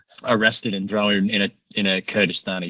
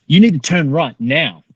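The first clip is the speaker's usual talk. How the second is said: raised, louder imitation of an urgent navigator; urgent order; 6 semitones higher, 7 dB louder than usual. Mate with paper map directs driver, acted urgent command. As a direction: call out a sudden direction to the driver.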